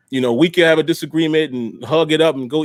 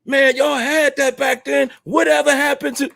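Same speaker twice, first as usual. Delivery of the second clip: higher, mock-whiny Smitty impression; imitating Smitty; 8 semitones higher than usual. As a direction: do Smitty's gripe, pitched up. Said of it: "here's a quick imitation of Smitty".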